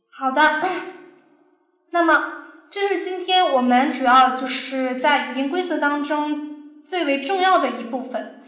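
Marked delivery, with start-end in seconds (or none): none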